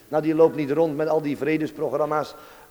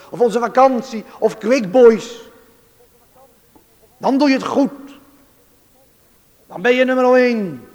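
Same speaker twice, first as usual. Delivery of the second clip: raised, insistent pulpit challenge; forceful preaching; 4 semitones higher, 6 dB louder than usual. Real, not acted. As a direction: confront the congregation with pointed questions. Challenges congregation at full force.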